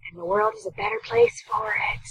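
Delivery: high, soft, sappy moral-of-the-story voice; after-school special voice. Acted. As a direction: deliver the lesson like a cheesy TV special. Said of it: "That was my after-school special voice".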